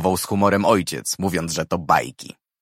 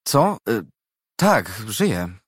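dazed, startled; slower than usual. A narrator's reading. Dazed girl answering rescuer.

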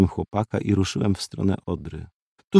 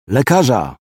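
calling out; 2 semitones higher, 3 dB louder than usual. A narrator's reading. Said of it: "zawołałem".

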